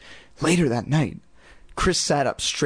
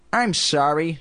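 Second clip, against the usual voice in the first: ordinary delivery, nothing marked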